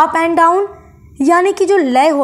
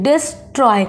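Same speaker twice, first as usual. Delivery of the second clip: "Destroy" with a gap after "डिस"; two separate syllables in "destroy", डिस and Troy; pause mid-word. Shown as a demonstration. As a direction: pause inside "destroy", letting "Troy" fall. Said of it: "एक बार pause लेना पड़ता है बीच में".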